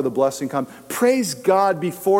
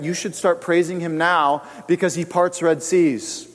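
ordinary talk

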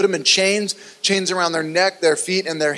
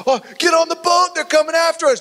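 high, panicked cry to flee; squeaky panicked disciple; scared disciples; 13 semitones higher, faster than usual. Pretend. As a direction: yelp it like a frightened follower. Disciples scared, acted quote.

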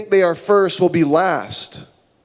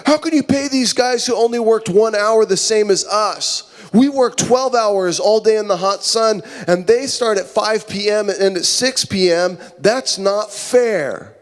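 higher-pitched indignant protest; mock-aggrieved worker protesting, pitch raised; angry grumbling; 4 semitones higher than usual. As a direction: complain to the boss, outraged at being cheated. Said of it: "get mad and they start grumbling at the landowner".

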